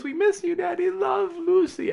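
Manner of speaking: put-on high, small child's voice reading a note. Reading the child's note to Daddy, high small voice. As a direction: voice the little girl's card, sweet and slow. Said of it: "crude but painstaking hand of a five-year-old".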